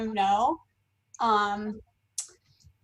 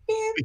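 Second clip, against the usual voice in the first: sonar beep; 11 semitones higher than usual. Retold or imitated. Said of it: "The sonar radar".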